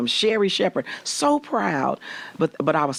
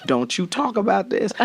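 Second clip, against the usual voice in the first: normal talking voice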